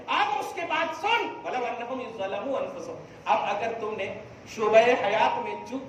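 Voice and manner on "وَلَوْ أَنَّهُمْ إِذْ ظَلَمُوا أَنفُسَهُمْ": Quran recitation. Real, not acted.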